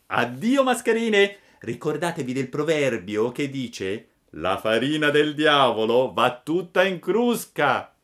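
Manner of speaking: a cheeky boy mocking two swindlers; scornful puppet. A narrator's reading. Pinocchio repeats his scorn.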